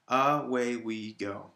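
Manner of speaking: slow "Away we go" in four even beats; separated syllables. Shown as a demonstration. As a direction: count out "Away we go" syllable by syllable, slightly joined. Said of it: "separate all of the syllables".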